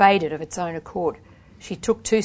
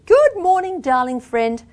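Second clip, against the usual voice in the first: high, loud singsong hello; tuneful greeting; 10 semitones higher, 9 dB louder than usual. A narrator's reading. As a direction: greet them in a showy, musical lilt. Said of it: "She greeted me tunefully".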